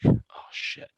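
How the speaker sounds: a breathless whispered oh shit; whispered curse. Retold or imitated. Whispering to himself as soldiers surround the car. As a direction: hush it, scared at the checkpoint.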